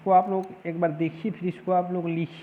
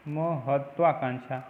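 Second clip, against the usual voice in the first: ordinary talk, nothing marked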